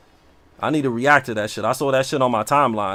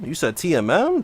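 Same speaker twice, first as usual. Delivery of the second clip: ordinary talk, nothing marked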